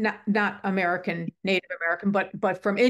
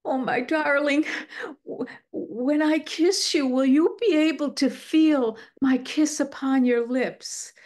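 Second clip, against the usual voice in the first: higher, slow imitation of a yearning lover; romantic husband; 5 semitones higher than usual. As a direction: act out a lovelorn husband pleading tenderly. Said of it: "one of my favorite things, he said".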